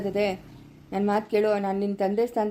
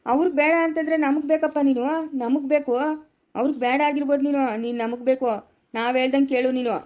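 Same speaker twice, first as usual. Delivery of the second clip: raised, emphatic reassurance; forceful insistence; 5 semitones higher, 3 dB louder than usual. Acted. Elder tells rejected man he is needed, forcefully insistent. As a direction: insist firmly, pitch pushed up.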